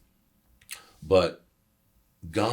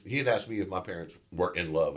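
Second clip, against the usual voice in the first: ordinary delivery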